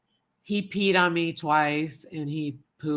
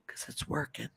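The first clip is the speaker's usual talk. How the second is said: whispering; 3 semitones lower, 12 dB quieter than usual. Real, not acted. Whisper trailing.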